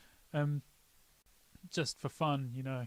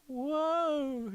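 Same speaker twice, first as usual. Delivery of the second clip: high, stunned exclamation; mock amazed whoa; 10 semitones higher than usual. Mother reacting to his apology song, amazed whoa. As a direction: gasp it out amazed. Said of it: "And then she's like".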